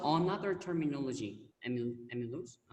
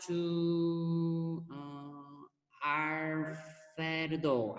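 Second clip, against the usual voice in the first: drawn-out call; much slower than usual. Real, not acted. Calling next student.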